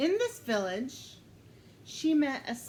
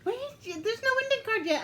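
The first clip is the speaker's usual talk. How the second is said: surprised protest; 11 semitones higher, faster than usual. Real, not acted. Interrupts premature ending.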